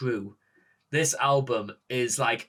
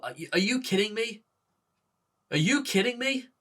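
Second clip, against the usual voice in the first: stunned disbelief; 8 semitones higher than usual. Real, not acted. Stunned by the album ending.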